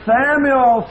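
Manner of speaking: loud, high call from above; voice of God calling. A narrator's reading. God calling little Samuel from the night. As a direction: call the boy's name like God waking him. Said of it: "And the voice said".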